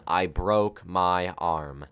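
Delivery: slow, weighty announcement of the injury; slow dramatic reveal. Acted. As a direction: drag out the big reveal. Dramatic title of story.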